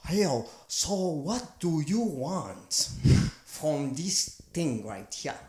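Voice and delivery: slow, drawn-out mock Chinese accent; a hint of a Chinese accent, stretched slowly. Mocking a Chinese accent while describing the ugliest accent. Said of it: "Chinese is probably".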